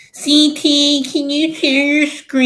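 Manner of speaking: high, monotone put-on whine; exaggerated high-pitched griping; mock complaining. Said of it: "I should complain and be like".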